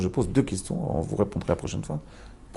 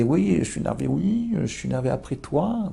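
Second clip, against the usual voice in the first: Rachel voiced; 4 semitones higher than usual. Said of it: "je vous l'arrange un peu".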